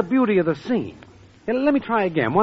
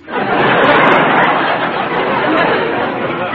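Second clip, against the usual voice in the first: hearty laugh; 7 semitones higher, 4 dB louder than usual. Acted. Al laughs at Joe.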